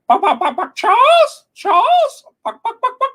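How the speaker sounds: very high, loud squawking chicken calling Charles; hammed-up crying hen, shrieking and fading; mocking chicken clucking. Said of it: "She was like".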